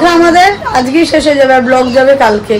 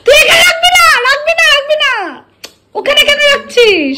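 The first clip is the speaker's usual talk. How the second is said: high scolding. Real, not acted. Scolds the girl over the plate.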